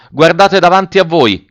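high, loud officer's call; shouted order. A narrator's reading. Officer warning his soldiers to watch ahead, shouted. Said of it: "gridò l'ufficiale ai soldati".

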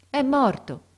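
high, loud cry of grief; anguished cry. A narrator's reading. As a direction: cry out the death in shock. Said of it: "gridò il ragazzo". (grido alto e disperato)

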